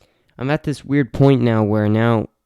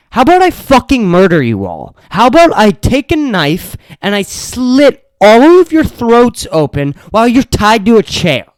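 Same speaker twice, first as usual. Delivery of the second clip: high, loud, seething threat; higher, louder venting at the house guests; furious rant; 11 semitones higher, 8 dB louder than usual. Real, not acted. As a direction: unload the rage in a raised, loud voice.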